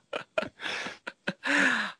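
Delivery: high laughing squeal. Pretend. Host squeals playfully to start the cheerful half. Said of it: "キャーでいきましょう".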